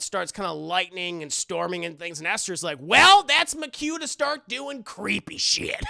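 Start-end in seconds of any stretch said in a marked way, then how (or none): 2.8-5.8 louder, higher, slow and gleeful; mock villain cue. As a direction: relish the creepy plan. Mock Esther line.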